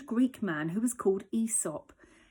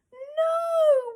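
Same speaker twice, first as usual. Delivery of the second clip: very high, loud wail; whining; 5 dB louder than usual. Acted. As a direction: whine a long no. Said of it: "whined Dog".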